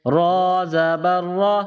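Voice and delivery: را drawn out slowly with a full heavy r. Shown as a demonstration. Teacher showing pupils a heavy را. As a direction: sound را thick and slow. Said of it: "را کو موٹا پڑھیں گے".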